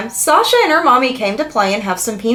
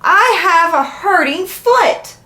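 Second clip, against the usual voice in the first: storybook shout; 8 semitones higher, slower than usual. A narrator's reading. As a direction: call it out slowly as a child's complaint from behind. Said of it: "so I had to shout".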